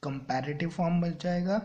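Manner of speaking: ordinary talk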